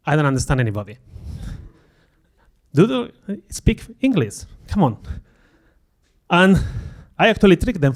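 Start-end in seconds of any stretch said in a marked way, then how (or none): 2.7-5.0 high, slow disbelieving question; incredulous. Real, not acted. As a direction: ask it like you can't believe it. Disbelief at UK accents.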